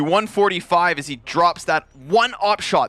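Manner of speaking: dramatic caster with punched, stretched key words; live play-by-play. Real, not acted. Caster dwells on the dropped op shot, dramatic play-by-play. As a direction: hammer home the missed chance.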